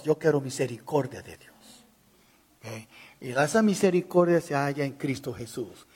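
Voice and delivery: ordinary delivery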